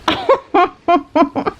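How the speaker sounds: high giggle. Real, not acted. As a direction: giggle at the cheesiness. Laughing at cheesy line.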